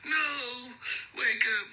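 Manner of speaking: high mock sobbing plea over a dead friend; hammed-up wail, pitched high. Mocking a homie who cries for [name], high mock wail.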